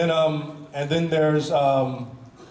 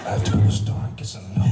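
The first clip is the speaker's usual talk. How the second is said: fast, intense, half-laughing take on the line; intense Colton Haynes; 5 semitones lower, faster than usual. Retold or imitated. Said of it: "Here's Colton Haynes".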